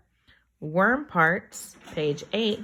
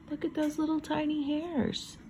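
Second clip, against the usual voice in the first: amazed gasp; 9 semitones higher, 5 dB quieter than usual. Acted. Gasps in wonder at picture.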